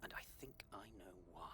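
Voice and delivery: very soft hushed whisper. Acted. Informant revealing his suspicion in a soft whisper. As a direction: breathe it out as a secret. Malik speaking secretly over comm.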